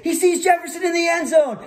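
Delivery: high, urgent play-by-play shout; announcer. Retold or imitated. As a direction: call the throw as it happens. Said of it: "I would turn into the announcer again".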